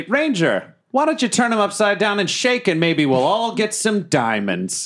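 high, drawn-out excited call; gleeful egging-on. Acted. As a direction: egg your friend on with mischievous glee. Player gleefully eggs on Ranger in character.

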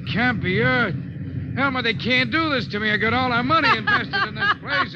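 panicked. Acted. Sam panicking to Elmer about his money, fast and high. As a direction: babble in rising disbelief.

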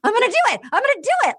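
high-pitched, urgent self-talk; mock psyching up. Dale talks himself into proposing, mock-excited. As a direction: hype yourself up in a high voice.

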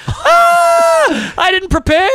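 high, loud panicked yell; mock panic. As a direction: shout it in comic alarm. Panic retold, shouting.

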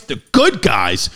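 slow deep mock hero voice. Shown as a demonstration. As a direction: drop the voice and drag out "good".

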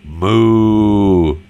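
long drawn-out moo; mock cow moo. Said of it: "Cow says".

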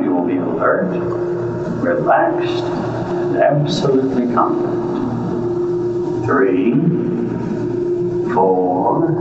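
hypnotist count-out. Acted. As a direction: count them out of trance gently.

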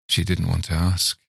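ordinary delivery

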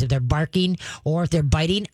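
ordinary talk, nothing marked

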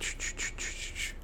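rapid soft unvoiced "shh" bursts; imitated page-flipping noise. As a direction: mimic a speed reader whipping through pages. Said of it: "He went".

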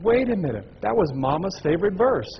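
she's just realized it; excited realization. Retold or imitated. Sister recognizing her mother's favorite verse, excited.

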